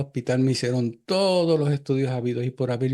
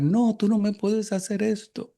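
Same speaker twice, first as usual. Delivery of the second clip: retold distant cry; 9 semitones higher than usual. Wife crying out to her dying husband, retold mild yell. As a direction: plead it higher, a mild mock yell. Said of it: "que empezó a gritar".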